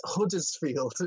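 "Huddersfield" slowly, with a sounded H; H pronounced. Shown as a demonstration. Explaining the town's name to co-hosts, "Huddersfield" with audible H. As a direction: say "Huddersfield" carefully, H and all. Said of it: "You just don't pronounce the H".

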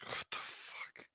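breathy, slow whispered disbelief; stunned whisper. Real, not acted. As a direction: barely voice it, floored. Stunned reaction to absurd claim.

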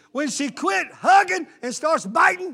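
high, loud and slow; shouted punchline. Acted. Preacher shouting a marriage joke at the men. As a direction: land the joke hard.

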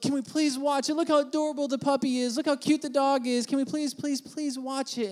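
high-pitched imitation of a begging girlfriend; mock pleading. Partner begs to watch the DVD.

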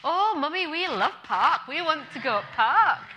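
a mum playing her Yorkshire boys, pleading for the park; Yorkshire child accent. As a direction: beg like an excited Yorkshire kid. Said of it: "I'm quite good at that Yorkshire accent".

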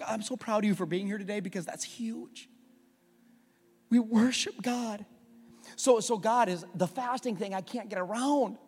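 ordinary delivery

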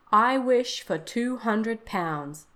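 slow, loud "I wish for two hundred pounds", each word separate; distinctly. A narrator's reading. Father making his wish on the talisman, "I wish for two hundred pounds" said distinctly. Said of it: "said the old man distinctly".

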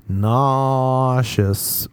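"Nauseous" with a very long stretched first vowel; drawn-out complaint. Acted. Interrupting whoever is trying to help, "Nauseous" stretched long. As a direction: draw out "Nauseous" slowly, NAAAU-shus.

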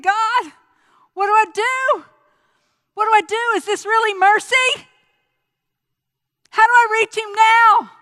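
loud, high pleading; begging heaven for an answer, loud; desperate cry to God. Retold or imitated. Remembering his cry to God at the brother's bedside, loud and pleading.

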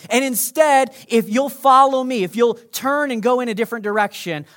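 loud, high, urgent warning from God; forceful warning. Acted. Preacher voicing God, loud and urgent. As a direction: call it out like a stern warning.

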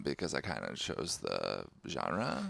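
low, slow creaky vocal fry. Shown as a demonstration. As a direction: drag it out low and creaky, rising at the end. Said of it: "wanna hear my Fry up talk".

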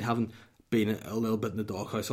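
ordinary talk, nothing marked

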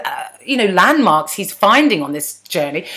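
ordinary talk, nothing marked

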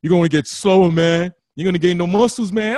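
loud mocking bro voice; loud teasing gym bro; people taunting. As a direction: bark it like a doubting buddy. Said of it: "People were saying".

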